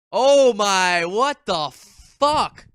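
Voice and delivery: loud, stretched shock; oh my, what the fuck; shocked outburst. Real, not acted. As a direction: recoil in disbelief. Shocked by creepy poem.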